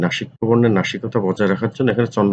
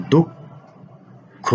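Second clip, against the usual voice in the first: দুঃখ held very slowly and higher; drawn-out high spelling; 7 semitones higher, much slower than usual. Shown as a demonstration. Teacher to students, slowly stretching দুঃখ. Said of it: "teacher stretches word for students".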